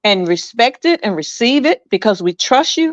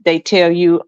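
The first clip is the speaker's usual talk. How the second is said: normal talking voice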